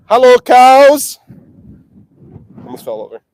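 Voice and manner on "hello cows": slow, drawn-out, loud high call across a field; sing-song hello to cattle; calling the cows. Real, not acted. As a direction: stretch it out to reach the herd.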